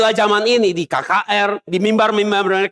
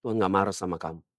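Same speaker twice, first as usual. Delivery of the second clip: much softer and lower, cooing comfort; mock reassuring pastor; 8 semitones lower, 6 dB quieter than usual. False pastor reassuring a punished sinner, soft mock comfort. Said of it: "Mereka bilang".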